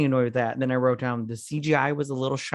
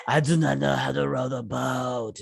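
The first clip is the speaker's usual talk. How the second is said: a hint of a raspy chief giving orders. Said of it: "She's like".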